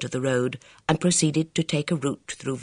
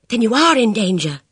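high, anxious rush of words; fretful girl's voice; agitated; 8 semitones higher, 7 dB louder than usual. Acted. Bridget voiced.

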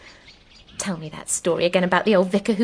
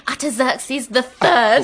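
slow, louder mock proclamation; mock-triumphant pulpit voice; imitating triumphant preacher; 4 semitones higher, 5 dB louder, slower than usual. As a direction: echo the vicar grandly, higher and drawn out. Echoing the preacher's triumphant line.

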